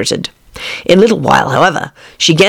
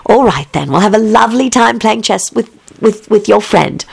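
maid voice; 5 semitones higher than usual. Acted. Voices Lucretia.

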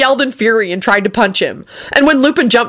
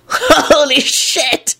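laughing; 9 semitones higher, much slower than usual. A narrator's reading. Lupin laughing.

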